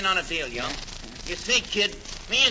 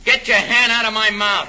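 loud; 6 dB louder than usual. Acted. Gangster startled in dark passage.

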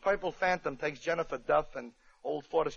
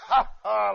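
short loud mock "Ha, ha"; demo bandit laugh; 4 semitones higher, 7 dB louder than usual. Shown as a demonstration. Narrator showing how the bandits laugh, mock laugh. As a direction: bark out a hearty fake "Ha, ha". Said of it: "like that".

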